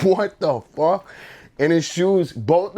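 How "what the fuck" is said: high, loud shocked question through laughter; can't believe what you're seeing; retelling own shock, laughing and high; laughing disbelief. Retold or imitated. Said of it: "I was like".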